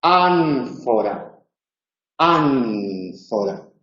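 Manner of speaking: ánfora drawn out slowly, lips to upper teeth on the N; slow model word. Shown as a demonstration. Teacher modelling ánfora for students to repeat. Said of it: "pronunciad conmigo".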